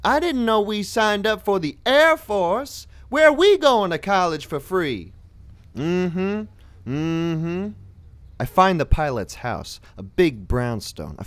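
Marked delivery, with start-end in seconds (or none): 0.0-5.1 high, mocking quip; sassy one-liner. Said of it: "I toss 'em one-liners like". 5.8-7.7 lightly voiced women agreeing; affirming chorus.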